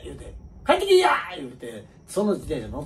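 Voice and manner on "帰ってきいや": high, loud mum calling out through laughter; high, loud, laughing cheery mum; cheerful mother calling. Retold or imitated. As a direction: call the kid home for dinner.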